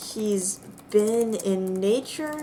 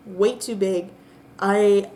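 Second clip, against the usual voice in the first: ordinary delivery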